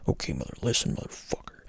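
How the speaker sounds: low, hushed menacing threat; serious mode. Retold or imitated. [name] in the barber chair threatens [name], low hushed menace. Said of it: "in serious mode in his chair".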